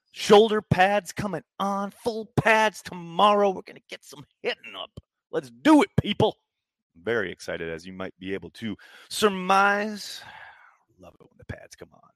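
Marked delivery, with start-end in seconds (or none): 0.1-6.4 high and loud, slowed for emphasis; pumped hype. Real, not acted. Pumped about pads coming on. 11.0-12.1 whisper. Real, not acted. Quiet aside after the hype.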